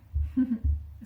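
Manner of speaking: small laugh that slips out; short stifled laugh. Real, not acted. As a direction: let a brief chuckle escape, then catch it. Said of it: "Sorry, I shouldn't laugh".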